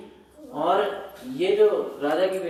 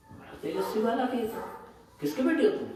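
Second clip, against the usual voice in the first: higher-pitched curious question, mildly acted; acted wonder; 7 semitones higher, faster than usual. Queen asks a radiant boy whose child he is, acted wonder. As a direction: voice someone struck by an unknown child.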